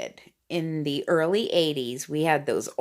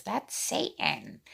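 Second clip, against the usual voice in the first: mock gossip; 2 semitones higher, 8 dB quieter, slower than usual. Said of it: "which everybody says".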